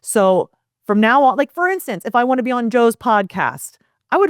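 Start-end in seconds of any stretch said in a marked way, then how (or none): none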